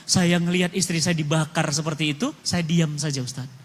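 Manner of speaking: low and soft, words fading out in grief; mild imitation of a man sobbing as he confesses; sobbing confession. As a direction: prisoner sobbing to the preacher, understated. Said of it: "dia sambil sesegukan".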